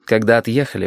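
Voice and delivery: plain reading voice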